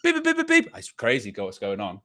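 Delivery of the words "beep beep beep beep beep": high-pitched imitation of a reversing car; mock reversing alarm; reversing beeps. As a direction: beep like a truck backing up. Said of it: "as he's reversing into his".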